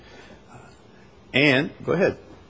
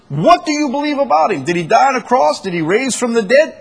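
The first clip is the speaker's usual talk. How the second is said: high, loud volley of questions; impassioned challenge; 11 semitones higher, 4 dB louder than usual. Real, not acted. Preacher challenging believers on what doctrine is, impassioned.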